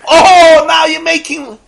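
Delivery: loud high outraged cry; indignant shout. Retold or imitated. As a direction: blast it in disbelief. The accuser shouts again.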